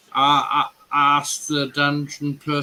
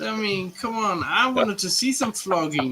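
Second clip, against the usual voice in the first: higher-pitched, defensive protest; mock-indignant protest; 7 semitones higher than usual. Real, not acted. Man teased by friends about his dungeon visit, protesting. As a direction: protest the teasing with a raised pitch.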